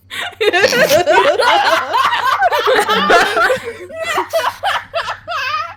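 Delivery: big high burst of laughter; loud laughter. Real, not acted. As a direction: lose it completely laughing. Table erupts laughing.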